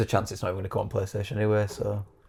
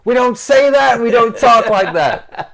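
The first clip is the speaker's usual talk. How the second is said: high, loud, slow New York voice; mock New York accent; 12 semitones higher, 12 dB louder, much slower than usual. Mocking friend's accent attempt.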